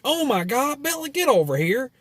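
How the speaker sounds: very high mock southern holler; hammed-up, high southern twang. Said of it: "with a southern accent".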